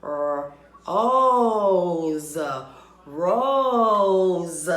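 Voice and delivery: rose built from R-, then O's, then loud whole word; R- cut, O's swelled, rose landed loud; blending sounds. Shown as a demonstration. Teacher sounding out rose loudly for class. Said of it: "Let's blend that, that word again".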